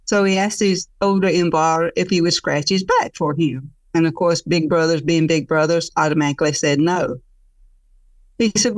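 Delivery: usual speaking voice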